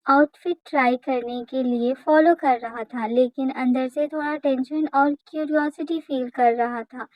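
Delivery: plain reading voice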